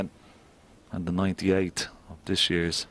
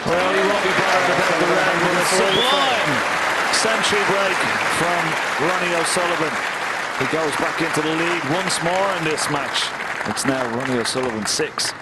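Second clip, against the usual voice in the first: rising, loud live commentary; excited commentary; 11 semitones higher, 7 dB louder than usual. Real, not acted. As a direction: call the century with excitement. Excited commentary after century break.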